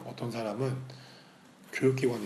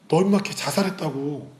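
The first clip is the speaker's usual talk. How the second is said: raised, drawn-out voice of a friend breaking news; urgent retold outburst; 5 semitones higher, 8 dB louder, slower than usual. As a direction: blurt it loudly down the phone, stretching the words. Retold friend's shocking phone call. (다급하게 목소리 높여 늘여 말하기)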